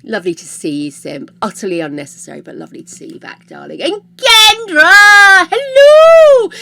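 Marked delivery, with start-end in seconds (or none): 3.8-6.5 high, loud, slow sing-song hello; excited greeting. Real, not acted. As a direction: call the name out high and bright. Greeting a viewer loudly.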